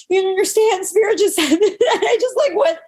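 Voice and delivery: high, laughing overwhelmed words; bursting with emotional laughter; laughing through tears. Retold or imitated. To the nurse after waking, high laughing outburst. Telling nurse why she cries.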